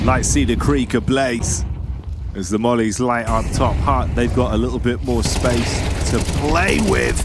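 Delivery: live esports casting; play-by-play commentary. Acted. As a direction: call the round as it unfolds.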